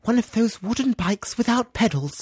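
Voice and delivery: very high, put-on little child voice; idealised child. Imagined son telling Daddy his wish, high earnest child voice. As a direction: pipe up like an earnest small child. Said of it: "hope that maybe your children will wish for".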